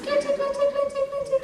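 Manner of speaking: "glitty, glitty" sung out high; high call. Shown as a demonstration. Teacher calls the dragons with "glitty" high and clear. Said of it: "you just go".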